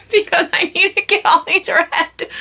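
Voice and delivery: high laughing line, amused at herself. Real, not acted. Vlogger laughing about her huge reading pile, laughing. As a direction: laugh through it, a bit overwhelmed.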